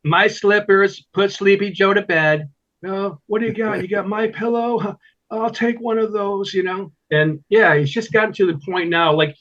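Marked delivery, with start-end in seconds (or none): 0.0-6.5 mock pillow ad. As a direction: sell it like a late-night infomercial, lightly hammed. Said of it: "Mike Lindell to come out with a My Pillow".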